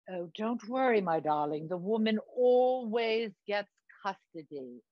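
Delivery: grand, slowing British reassurance; theatrical British, stretching the final phrase; put-on British accent. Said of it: "what's with the accent?".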